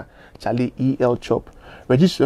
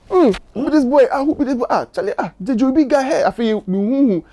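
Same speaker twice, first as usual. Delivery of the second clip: put-on much higher, wondering who the new boy is; retold surprised praise; amazed; 9 semitones higher than usual. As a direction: act out someone stunned by a new voice. Imitating an impressed listener.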